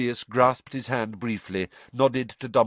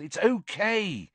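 high, stretched-out call; yelled reassurance; 12 semitones higher, slower than usual. A narrator's reading. Son calming his alarmed mother, yelling. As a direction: call out urgently that all is well. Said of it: "Ron yelled".

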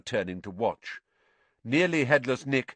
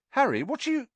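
put-on puzzled girl voice; faster than usual. A narrator's reading. Confused friend interrupted.

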